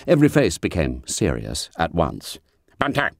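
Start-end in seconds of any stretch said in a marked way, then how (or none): 2.8-3.1 bitten-off name. A narrator's reading. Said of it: "bite off the name".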